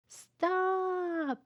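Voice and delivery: long, high, loud scream. A narrator's reading. Children screaming at the dog in the street, long scream. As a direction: cry out as the dog runs into the street. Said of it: "the four children screamed".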